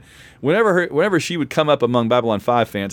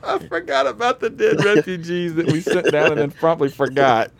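amused disbelief; 5 semitones higher than usual. Real, not acted. Cracking up over forgotten refugees.

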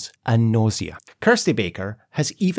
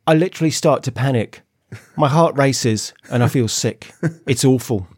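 put-on English accent; mock-quote in an English accent. Scottish host quotes Kirsty on her panic, put-on English accent. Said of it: "do an English accent for this".

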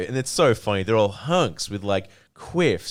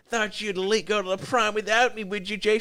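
high, put-on voice of a girl with a chainsaw; mock daydream killer; 10 semitones higher than usual. Girl daydreaming revenge on her prom date, mock menacing. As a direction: voice her teasing him, pitched up. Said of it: "being like".

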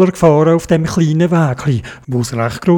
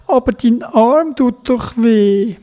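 higher, slow, pleading; worried child; 8 semitones higher, slower than usual. A narrator's reading. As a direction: gently protest through worry. Theobald worries about grandma. (besorgt, langsam, höher)

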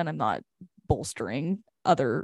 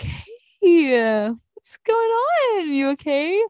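higher, slower caring check-in, lightly voiced; concerned women's director; 11 semitones higher, 5 dB louder, much slower than usual. Women's director stops to check on the crying camper, higher and slower with concern. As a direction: gently ask if she's okay.

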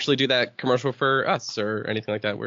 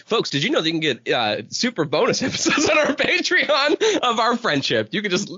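sincere ad plug; 7 semitones higher than usual. Acted. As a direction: sell it like a heartfelt ad. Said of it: "sounded like a really genuine plug".

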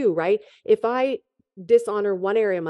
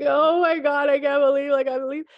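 retold crying; 7 semitones higher than usual. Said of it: "I was bawling my eyes out".

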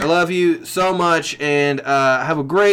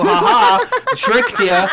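laughing gotcha; 4 dB louder than usual. Real, not acted. Gleeful gotcha.

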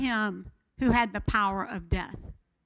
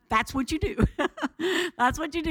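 chuckling through the words; laughing; 3 semitones higher, faster than usual. Real, not acted. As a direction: laugh as you say it.

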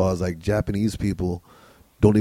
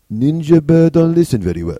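mock Japanese accent; 5 semitones higher, 8 dB louder than usual. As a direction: read the raccoon diary in a hammed-up Japanese accent. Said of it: "when you do the accent".

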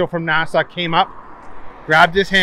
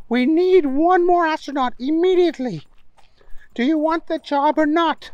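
high, bossy scientist voice; high professor voice; 13 semitones higher than usual. Acted. Stinkwell voiced in story.

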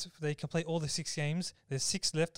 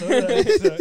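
much louder and higher, excited; 12 semitones higher, 15 dB louder than usual. Retold or imitated. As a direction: burst out with it.

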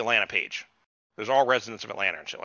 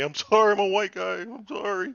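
mock apology; 7 semitones higher than usual.

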